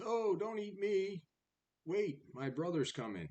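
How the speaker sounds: lightly voiced kid goat, starting high; a hint of a small goat's plea; pleading little goat. Little billy goat begging the troll to wait, lightly acted plea.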